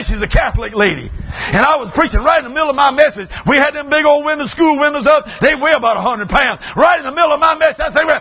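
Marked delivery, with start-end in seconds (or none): 1.4-8.1 high, emphatic pulpit holler; fired-up preaching. Real, not acted. Preacher telling the roach story, fired-up preaching.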